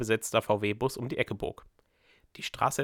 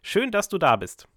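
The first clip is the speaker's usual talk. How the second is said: glad welcome; 8 semitones higher, 5 dB louder than usual. Acted. Dominik welcomes warmly.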